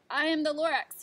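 coughing through the words. A narrator's reading. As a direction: speak with a wheezy cough. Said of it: "he coughed and he whiffed".

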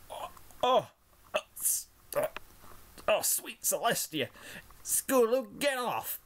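high filly voice, choking and gasping; a young filly crying out between gags; gagging cry. A narrator's reading. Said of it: "Applebloom cried in between bouts of gagging".

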